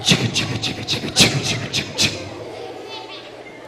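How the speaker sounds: whispered chicka chicka like a train engine; train chugging sound. Retold or imitated. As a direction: run like a steam train, breathy and rhythmic. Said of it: "That's how he runs".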